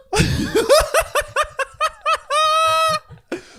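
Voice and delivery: high laughing fit; loud laughter. Real, not acted. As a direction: burst out laughing. Cracks up.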